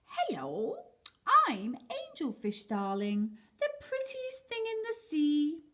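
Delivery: a hint of a preening, vain fish; lightly voiced vain angelfish. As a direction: show off how gorgeous you are.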